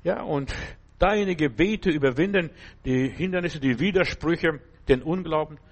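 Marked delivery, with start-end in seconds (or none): none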